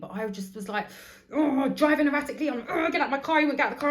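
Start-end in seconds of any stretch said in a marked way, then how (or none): 1.3-2.5 high, loud retold outburst; yelling at the passenger while driving; mock yelling in the car. Mum in the car, reenacting her outburst at her son, loud and high. 2.7-3.4 high, loud retold yell; mock shout. Mum in the car, yelling at her son to get out, loud and high.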